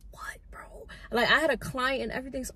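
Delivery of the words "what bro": hushed, disbelieving whisper; stunned whisper. Retold or imitated. Stylist whispering back at her yelling boss, stunned. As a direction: breathe it out in disbelief.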